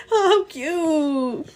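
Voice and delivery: normal talking voice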